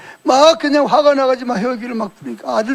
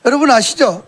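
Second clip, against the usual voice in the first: rising preaching; 6 dB louder than usual. Real, not acted. Preacher addresses the congregation.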